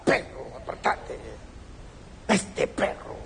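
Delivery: breathy muttered brush-off to a dog; annoyed whispered shoo; gruff muttered shoo. Retold or imitated. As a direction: mutter it under your breath, shooing the dog. Kicking dog out of way.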